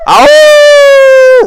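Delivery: loud high oh; startled high shout; sudden high yelp. Acted.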